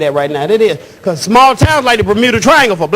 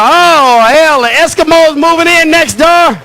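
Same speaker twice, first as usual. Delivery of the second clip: mock bigot complaint; 6 semitones higher than usual. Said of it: "looking out the window and go".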